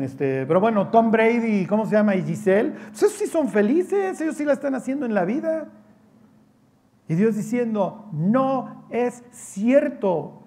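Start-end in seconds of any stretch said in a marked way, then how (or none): 2.7-5.7 higher, sing-song mock awe; mock admiring. Preacher mocking the belief that celebrities are happy, high and gushing. 8.1-10.4 very slow, drawn-out denial; God's flat denial. Acted. God answering the crowd's illusion, very slow.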